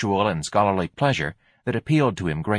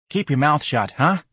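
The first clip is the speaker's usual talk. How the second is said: higher-pitched street character voice; sneering warning; 6 semitones higher than usual. A narrator's reading. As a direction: warn him, pitching up on the tag question. George warns Ignatius as he leaves.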